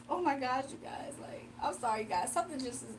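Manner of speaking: soft, put-on dejection; mock hurt. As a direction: play wounded for the audience, voice sinking low. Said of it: "he wants me to be like this".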